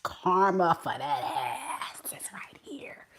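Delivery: wheezy laugh through the words; giggling hush; laughing whisper. Real, not acted. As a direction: laugh it out under your breath. Laughing while saying karma.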